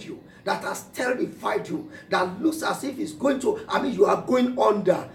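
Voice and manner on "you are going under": ordinary delivery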